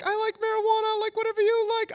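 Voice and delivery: very high-pitched, over-eager mock falsetto; squeaky eager agreement. Nervous teen agreeing with his crush, in squeaky falsetto. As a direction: squeal it, desperate to please.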